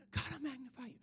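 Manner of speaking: hushed, private praise; quiet worshipper. Acted. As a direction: murmur it softly to God. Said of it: "you've got one person here".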